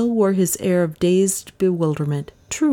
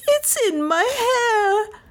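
very high, drawn-out shriek without added volume; mad scream; slower than usual. A narrator's reading. Lizzie feels the bat on her, screaming madly. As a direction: shriek it slowly in panic. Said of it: "she screamed madly".

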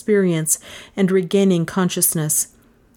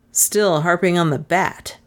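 plain reading voice

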